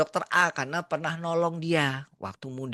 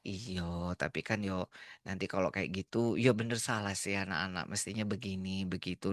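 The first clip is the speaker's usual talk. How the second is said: much softer, lower, hesitant pushing back; soft reasoning; 9 semitones lower, 8 dB quieter than usual. Retold or imitated. Nurse softly reasoning with the angry doctor. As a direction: reason gently, almost under the breath.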